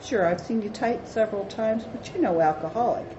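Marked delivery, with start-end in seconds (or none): none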